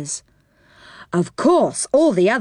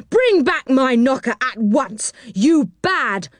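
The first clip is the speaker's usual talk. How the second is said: high-pitched indignant scolding; angry; 6 semitones higher, slower than usual. A narrator's reading. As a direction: scold a naughty boy crossly. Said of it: "he cried angrily".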